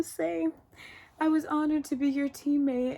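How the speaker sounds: high, tearful goodbye; crying farewell. Retold or imitated. Player two forty saying goodbye to Sae-byeok, tearful and high. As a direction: voice her friend's last words through tears. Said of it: "and then she's crying".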